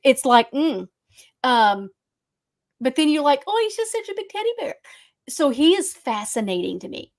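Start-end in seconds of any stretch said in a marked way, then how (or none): 3.4-4.8 high, quick cooing; mock gushing. Fan fooled by a killer's charm, gushing. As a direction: coo it as over something cute. Acted gushing over a teddy bear.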